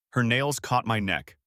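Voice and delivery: ordinary delivery, nothing marked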